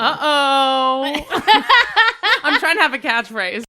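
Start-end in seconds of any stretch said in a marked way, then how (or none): 1.3-2.4 burst of high laughter. Real, not acted. Guest laughs at own intro.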